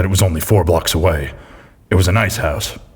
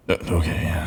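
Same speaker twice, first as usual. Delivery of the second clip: whisper; 7 dB quieter than usual. Acted. Muttering to the dead cat.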